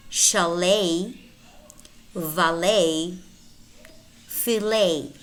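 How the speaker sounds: ballet, crochet, buffet and the rest end in "ay"; French-style silent T. Shown as a demonstration. Teacher models French-origin words, silent final T as in ballet. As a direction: end each of ballet to filet on a long "ay", no T. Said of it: "the letter T is replaced".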